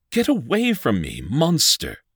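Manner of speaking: high, loud panicked shout; shrieking in terror. A narrator's reading. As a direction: shriek at him to keep away. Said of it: "she shrieked".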